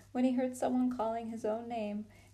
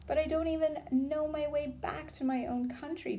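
pleading; 6 semitones higher than usual. A narrator's reading. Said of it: "pleaded Edmund".

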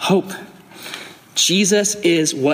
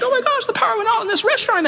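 much higher, fast hammed-up panic; mock panicked wife; faster than usual. Brooklyn on the phone during the blackout, hammed-up high panic. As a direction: squeal it in a mock frantic high voice. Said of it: "And she's like".

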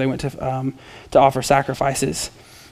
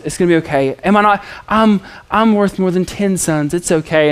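lightly voiced clueless husband, a touch higher; mock reassuring husband; 5 semitones higher than usual. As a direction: play the husband patting her, half-joking.

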